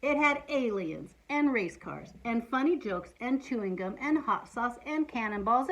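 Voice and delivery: ordinary delivery, nothing marked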